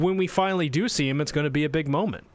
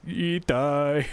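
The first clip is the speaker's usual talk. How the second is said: mock dying rat voice.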